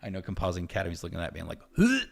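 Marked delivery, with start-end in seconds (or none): 1.8-2.1 high, loud, confused huh; baffled huh. Retold or imitated. Compositors looking at his messy node, baffled high huh. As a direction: yelp it in disbelief.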